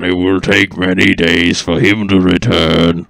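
gruff fat man voice. Acted.

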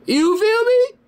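very high falsetto, rising at the end; high squealed taunting question; mocking taunt. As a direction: needle them in a squeaky voice. Taunting mother after counter-accusation.

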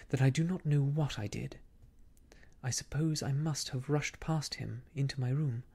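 usual speaking voice

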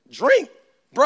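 ordinary delivery

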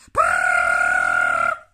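sudden, very loud trill; ringing alarm clock. Acted. Alarm clock shatters the bear's peace, blaring trill. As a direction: burst out with a long rattling ring. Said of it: "Went Missus Bear's alarm clock".

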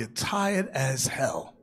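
slow breathy whisper of exhaustion; mock winded whisper. Preacher recalling the climb out of the valley, mock winded whisper. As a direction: let it out like you're out of air.

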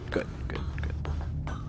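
whisper. Real, not acted. Player sneaking ahead, whispering to himself. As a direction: breathe the words under your breath. Player creeping forward cautiously.

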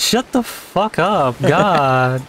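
high, drawn-out whine; mock exasperation. Telling a teasing friend to shut up, mock exasperation. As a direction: stretch it into a put-on high complaint.

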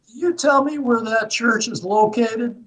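high Southern lady voice, imitated; thick Southern accent. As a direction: mimic an earnest old Southern woman asking directions. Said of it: "with a thick Southern accent".

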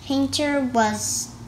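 ordinary delivery

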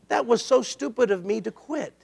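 normal talking voice

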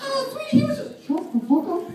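high cartoon squeak, cut off mid-excuse; flustered mouse snapping; Minnie voice. Minnie covering up to Mickey, high squeak.

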